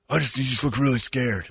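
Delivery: put-on voice of a tactless colleague; Jeremy. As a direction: lightly mimic a colleague's fussy concern. Mocking retelling of Jeremy's remark.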